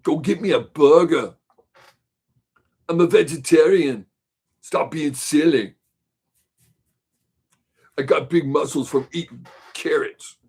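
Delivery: hammed-up Austrian action-hero voice; joking Austrian strongman impression; Arnold voice. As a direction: play the muscleman with a thick Austrian accent. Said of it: "I couldn't talk like that all the time".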